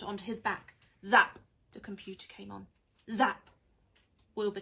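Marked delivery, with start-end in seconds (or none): 1.0-1.4 zap. Acted. Computer zaps. 3.1-3.5 zap. Acted. Computer zaps.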